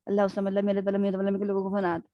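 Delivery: mechanical, hurried Quran recitation; rushed recitation. Shown as a demonstration. Teacher shows students a careless recitation. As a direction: rattle it off without thinking. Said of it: "اب میں پڑھ رہی ہوں".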